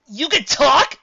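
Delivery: high, loud astonished question; incredulous outburst; amazed. A narrator's reading. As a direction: blurt it out in amazement.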